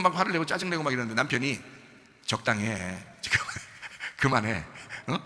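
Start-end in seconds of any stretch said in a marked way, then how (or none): 2.3-5.2 low, slow husband telling his wife to stop; husband's warning. Acted. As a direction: act a husband's restrained reproof. (낮게 타이르는 남편 말투)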